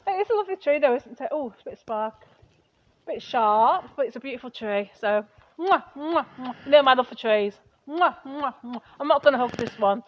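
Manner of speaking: very soft tender cooing; baby-talk a beloved tree. Acted. Wife coos and kisses her tree softly.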